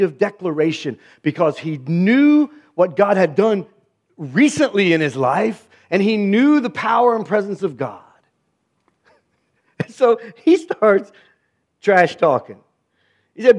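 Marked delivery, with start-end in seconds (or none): none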